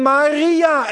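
loud call of a name; the risen Lord calling Maria aloud. Acted. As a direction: call her name across the garden. Jesus calls Mary by name.

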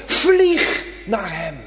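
slow, loud urging of vlieg; preacher correcting himself to a stronger word, slow shout; emphatic shout. Acted. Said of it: "Nee, ik zeg het anders".

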